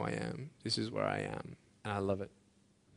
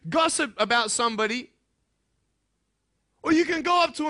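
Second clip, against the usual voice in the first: high and loud, urging the students; impassioned preaching; 14 semitones higher, 9 dB louder than usual. Acted. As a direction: drive it home like a preacher. Preaching at full force.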